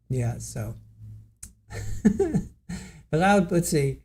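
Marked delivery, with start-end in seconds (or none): 1.7-2.5 sudden high-pitched laugh; real laughter breaking through; high giggle. Real, not acted. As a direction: crack up helplessly. Laughing at the racy passage.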